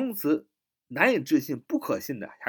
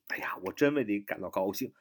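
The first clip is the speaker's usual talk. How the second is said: normal talking voice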